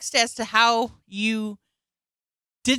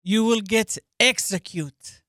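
put-on heavy Russian accent menace. Mock Russian threat.

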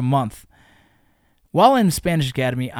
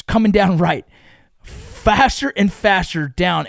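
usual speaking voice

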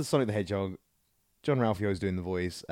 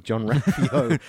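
name broken up by laughter; laughing; 5 dB louder, much slower than usual. Real, not acted. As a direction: repeat the name through a laugh. Laughs while repeating own exclamation.

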